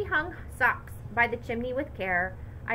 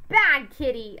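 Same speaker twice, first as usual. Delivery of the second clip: louder scolding shout; 3 semitones higher, 9 dB louder than usual. A narrator's reading. Child shouting at the cat over the mess, louder. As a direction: yell at the cat. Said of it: "I shouted".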